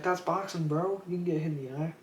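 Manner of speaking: high, mocking jeer; mocking crowd. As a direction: taunt him for whining.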